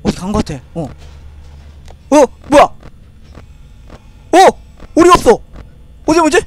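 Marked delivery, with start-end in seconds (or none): 2.1-6.4 sudden high panicked cry; alarmed. Real, not acted. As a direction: panic at the missing duck. The duck vanished, alarmed.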